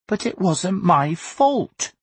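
put-on high, drawn-out boyish protest; furious protest. A narrator's reading. Augustus defending himself to his parents, furious protest. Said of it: "Augustus protested furiously".

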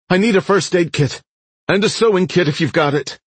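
high-pitched shouted demand; urgent shout. A narrator's reading. Man needing a first aid kit, urgent shout. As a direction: shout out what you urgently need.

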